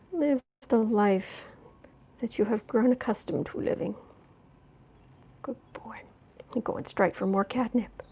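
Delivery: soft whispered pet talk; whispering to the cat. Real, not acted. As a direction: hush it to the cat. Murmuring to cat about catnip.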